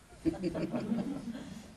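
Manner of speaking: high rising laugh; a quick giggle up high. Real, not acted.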